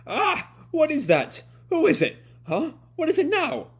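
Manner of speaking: high, loud frightened cries; panicked shout. Acted. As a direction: scream it like a scared child. Momo freaks out reading diary.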